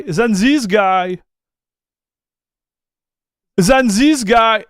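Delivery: slow, deliberate, stressing this; slow stressed repeat. Real, not acted. Streamer listing channels with fewer views, slow pointed emphasis. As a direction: drag out each guy you're pointing at. Comparing view counts, repeated.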